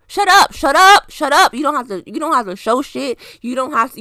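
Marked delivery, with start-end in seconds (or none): 0.1-1.5 higher and louder, fed-up repeated shut up; exasperated shut up. Real, not acted. Ranting at rich celebrities who film their giving, fed-up shout. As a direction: snap it over and over, fed up.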